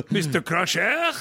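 Clouseau French accent on Mister Crusher; laughing French-accented Mister Crusher; mock French accent. Shown as a demonstration. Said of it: "who would have taken me seriously".

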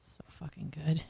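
breathy whispered praise. Real, not acted. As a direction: whisper it like a secret. Whispered aside.

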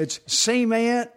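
high, slow drawn-out cement; mock Southern drawl. Joking back in a high stretched Southern drawl.

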